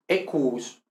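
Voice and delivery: "equus" slowly as E-kuus; "equus" with two U's run together; very long U. Shown as a demonstration. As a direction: draw out the double U of "equus". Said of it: "como una U muy larga".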